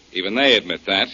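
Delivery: usual speaking voice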